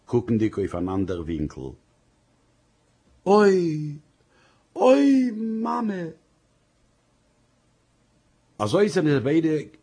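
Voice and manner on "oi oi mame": high, loud anguished wail rising to a cry. Acted. Wife breaks down before her husband, anguished wail. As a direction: wail "oi, oi, Mame" in despair.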